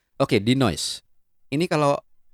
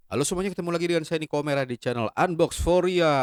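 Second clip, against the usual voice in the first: bright, raised YouTuber intro; higher, announce yourself to the channel; vlog intro greeting; 8 semitones higher than usual. Pretend. (sapaan pembuka vlog yang ceria)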